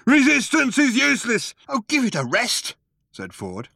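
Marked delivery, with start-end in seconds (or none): 0.1-1.5 loud, high slogan bellow; guard shouting. A narrator's reading. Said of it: "the guard shouting again". 1.7-2.8 high, fed-up retort; exasperated. A narrator's reading. Ford tired of guard's shouting.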